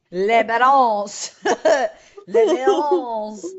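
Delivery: drawn-out, hammed-up French accent; mock French accent. Host teases co-host's reading of the surname, mock French accent. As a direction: stretch it in a teasing French accent, laughing. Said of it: "We French all of a sudden".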